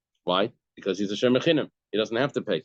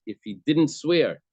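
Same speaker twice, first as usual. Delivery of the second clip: DIDN'T punched higher, then falling; stress on DIDN'T; 5 semitones higher, slower than usual. Shown as a demonstration. Teacher showing where the emphasis sits, stress on didn't. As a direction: lean on the word didn't. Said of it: "notice the emphasis of the ratio was".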